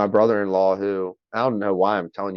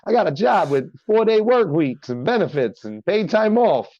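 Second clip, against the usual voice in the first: high, rolling Italian-American sales spiel; hammy Italian accent, pitched up; Tony; 8 semitones higher than usual. Shown as a demonstration. Tony listing job perks on radio, Italian accent. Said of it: "I just go in on this".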